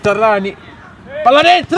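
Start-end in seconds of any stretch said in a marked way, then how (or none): 1.2-1.8 sudden rising call, ball in; excited shout. Real, not acted. Live goal commentary. (grido eccitato)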